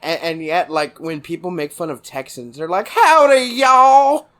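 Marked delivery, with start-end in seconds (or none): 2.9-4.3 high, loud caricature Texas drawl; hammed-up Texas twang, long vowels. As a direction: stretch it into a mocking Texan holler. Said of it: "they give us this long Texas drawl".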